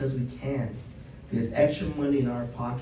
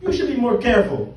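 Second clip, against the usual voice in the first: mock father voice; 12 semitones higher, 8 dB louder, faster than usual. Father's calm reply, performed.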